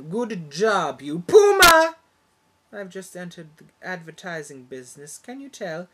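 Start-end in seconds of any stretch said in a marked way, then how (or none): 0.1-2.0 slow then a yelled Puma; ad slogan shout. Acted. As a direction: hype it like a commercial, ending in a yell. Mock ad slogan.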